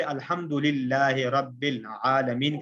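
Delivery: each Arabic word drawn out distinctly; slow, separated recitation. Shown as a demonstration. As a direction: recite unhurriedly, part by part. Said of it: "الگ الگ سے".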